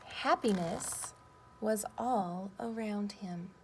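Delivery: slow, gentle story ending; reading to children. Acted. Teacher finishing the story for her class, slow and gentle.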